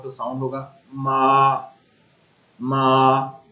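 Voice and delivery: मा held level and steady; first tone ma. Shown as a demonstration. Teacher repeating the level first tone for students. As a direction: keep मा flat and even. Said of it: "मैं एक दफ़ा दोबारा repeat कर देता हूँ".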